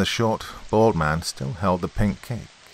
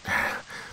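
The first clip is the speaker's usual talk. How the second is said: sigh; 8 dB quieter than usual. A narrator's reading. Character sighs before complaint.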